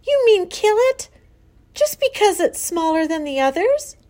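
high, loud, appalled questions; shrieking. A narrator's reading. Daughter challenges mother over killing the runt, shrieking. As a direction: protest shrilly in disbelief. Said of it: "shrieked Fern".

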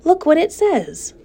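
put-on high, eager little girl; excited child. A narrator's reading. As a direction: Fern shows everyone the web, high and eager. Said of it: "cried Fern".

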